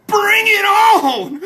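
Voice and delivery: loud, high, stretched-out yell; drawn-out holler. Pretend. Streamer hyping the beer, long high holler.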